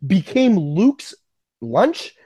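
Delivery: ordinary delivery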